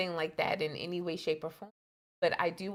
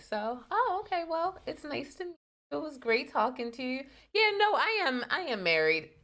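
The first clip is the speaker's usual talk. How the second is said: higher, flustered polite brush-off; awkward polite brush-off; 8 semitones higher than usual. Retold or imitated. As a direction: fumble then announce marriage. Acting her awkward rejection.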